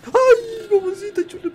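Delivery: high frightened cry; startled yelp. Real, not acted. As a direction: jump in real fright. Startled by a corpse.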